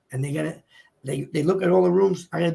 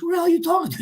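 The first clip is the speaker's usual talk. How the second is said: mock exasperation, pitched up high; exasperated wife; 10 semitones higher, much faster than usual. Wife woken at one thirty by husband's talking, mock exasperated. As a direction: lightly imitate a wife jolted from sleep.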